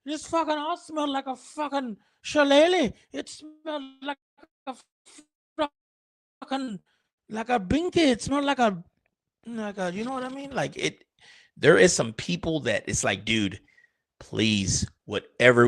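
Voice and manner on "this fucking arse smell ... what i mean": high, hammed-up Irish voice; Irish accent. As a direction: play a rowdy Irishman, pitched up. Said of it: "like I was freaking Irish".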